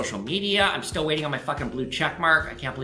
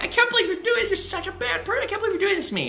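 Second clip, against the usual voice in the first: high imitated sobbing reproach; crying; faster than usual. Girlfriend accusing boyfriend of breaking up, imitated tearful high voice. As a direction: quote her through tears, pitched high. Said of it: "she's, like, crying".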